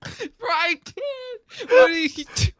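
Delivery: high, wheezing laugh-cry; crying with laughter. Real, not acted. Laughing at a friend's driving panic, wheezing through tears. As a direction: barely get the words out through laughter.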